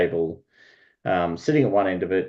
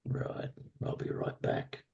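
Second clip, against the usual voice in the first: very low, whispered servant voice; hushed servant; 10 semitones lower, 15 dB quieter than usual. Acted. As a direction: murmur it almost under your breath. Servant withdraws from the door.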